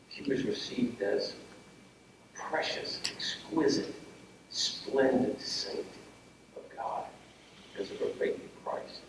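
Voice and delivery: sinking to a near whisper, slow; hushed. Real, not acted. Pastor to grieving family, fading into a slow reverent whisper. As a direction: let it fade to a reverent whisper. Sermon climax dropping to hush.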